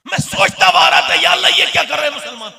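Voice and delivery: high, loud fiery sermon shout; impassioned preacher railing about banners and veils; preaching at full force. Acted. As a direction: thunder the rebuke at the congregation.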